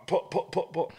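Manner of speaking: rising, strained mock pull; straining pulls. Friends mimic dad yanking the cord, strained.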